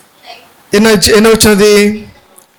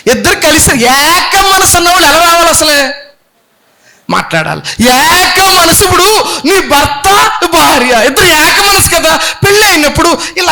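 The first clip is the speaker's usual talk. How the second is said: raised preaching; 6 semitones higher than usual. Real, not acted. Preacher raising voice.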